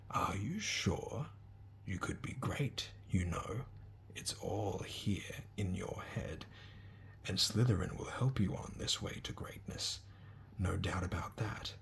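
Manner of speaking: slow, soft, musing whisper; an old hat thinking aloud; sorting hat whisper. Acted. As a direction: weigh him up in a hush, right in his ear. Sorting hat speaks in ear.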